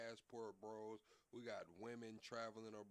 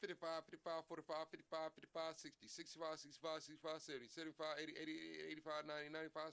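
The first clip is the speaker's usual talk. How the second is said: rapid auctioneer chant, raised pitch; 5 semitones higher, much faster than usual. Pretend. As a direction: call out bids like an auctioneer. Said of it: "he sounds like an auctioneer".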